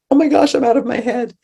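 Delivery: high, fast mock frenzy; breathless-sounding, squeaky overexcitement, hammed up; out-of-my-head excitement. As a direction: play an over-aroused dog. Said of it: "but not".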